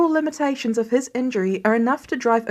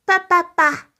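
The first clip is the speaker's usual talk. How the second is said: put-on baby babble; 7 semitones higher, 5 dB louder than usual. A narrator's reading.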